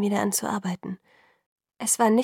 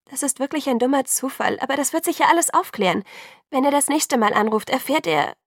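eager reassurance; 5 semitones higher, 4 dB louder than usual. A narrator's reading. Hurried reassurance of Alice.

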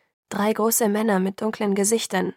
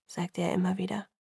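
plain reading voice

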